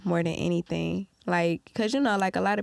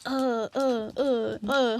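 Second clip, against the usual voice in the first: mock stammering; 3 semitones higher, slower than usual. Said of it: "a motherfucker be playing dumb and be like".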